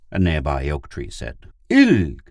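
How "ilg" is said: loud, high startled cry; gasped surprise. A narrator's reading. Pid discovers Ilg is the tree, gasped. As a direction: call out in astonishment. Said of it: "Pid gasped".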